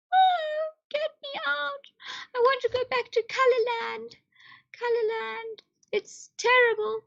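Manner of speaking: high, mock-trapped pleading; high playful wailing; mock despair. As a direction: plead to escape, hammed-up.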